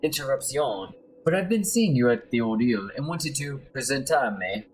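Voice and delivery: put-on amateur Spanish accent, lilting; courteous stranger with a put-on Spanish accent; Eduardo Carnella. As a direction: introduce yourself in a hammy Spanish accent. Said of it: "my horrible accent".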